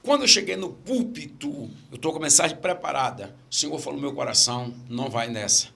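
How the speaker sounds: usual speaking voice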